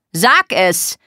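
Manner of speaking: short, forceful command; angry order. Acted. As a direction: order a confession. Er befiehlt wütend. (wütender Befehl)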